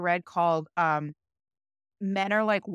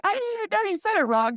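mock disbelief; 12 semitones higher, faster than usual. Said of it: "I was like".

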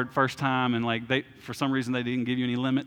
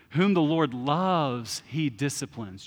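slow quote; much slower than usual. Retold or imitated. Quoting author, slowed scripture.

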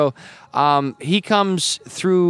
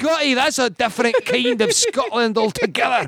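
high, hammed-up Scottish engineer; exaggerated Star Trek Scottish brogue, pitched high; Scotty; 9 semitones higher than usual. Comedian riffing on Star Trek for the host, hammed-up Scottish engineer. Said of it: "too much Star Trek watching".

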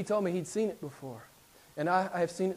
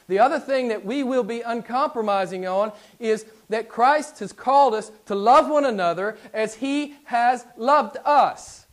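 louder, higher pulpit emphasis; preacher pressing an uncompromising point, loud; full-force preaching; 3 semitones higher, 5 dB louder than usual. Acted. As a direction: drive the point home to the congregation.

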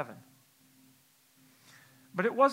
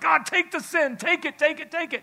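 pleading prayer; 11 semitones higher, 6 dB louder than usual. Retold or imitated. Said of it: "I'm like".

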